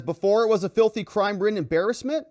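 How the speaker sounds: loud, high sarcastic jab; mock it with a sly jab. Judge's joke, they all laugh.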